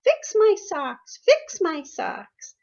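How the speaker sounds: high, slow whiny child wail, imitated; toddler tantrum. Mother imitating daughter's sock tantrum, high slow wailing child voice. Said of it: "start screaming at everybody".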